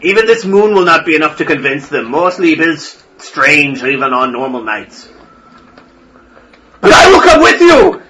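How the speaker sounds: put-on a scorned old priest finally rising up; agitated old priest. Priest ranting, then declares.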